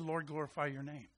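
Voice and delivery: soft, low prayer from a sickbed; hushed, yielded plea; quiet surrendered prayer. Retold or imitated. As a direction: murmur it low to the Lord. Voices a bedridden woman's prayer.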